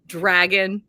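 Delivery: usual speaking voice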